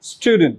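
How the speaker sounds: Student said with no pressure on the t; teacher demo, soft t in Student. Shown as a demonstration. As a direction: let the t in Student go light. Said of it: "I am not putting the pressure on this T".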